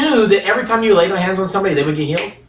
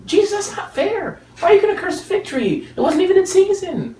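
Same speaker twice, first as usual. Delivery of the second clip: whiny fig tree; 13 semitones higher than usual. Acted. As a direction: plead like a wronged fig tree. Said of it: "It's like".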